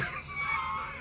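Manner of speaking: high burst of laughter. Real, not acted. Laughing at her own attendant impression, high laugh. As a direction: squeal of a laugh.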